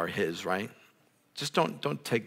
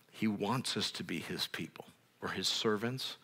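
whispered sermon line; 8 dB quieter than usual. Real, not acted. Preacher whispering to the congregation about God's people.

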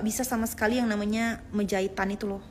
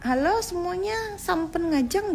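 higher, slow Balinese-accented greeting; 8 semitones higher, slower than usual. Shown as a demonstration. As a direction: greet the fans with a playful Balinese lilt, higher and slower. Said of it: "logat aja gitu maksudnya". (sapaan logat Bali, lebih tinggi dan lambat)

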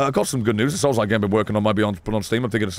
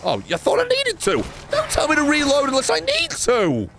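indignant outburst; 14 semitones higher than usual. Real, not acted. Game nagging him to reload.

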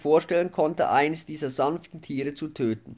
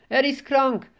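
wailing; 8 semitones higher than usual. A narrator's reading. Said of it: "manche jammerten".

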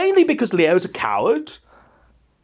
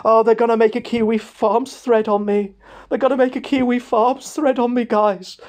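whiny Leo impression; 5 semitones higher than usual. Whiny Leo voiced in story.

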